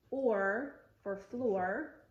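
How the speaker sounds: slow "or for floor", both R endings held; vocalic R demo. Shown as a demonstration. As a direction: stretch "or" and "floor" into the R. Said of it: "they are called vocalic Rs".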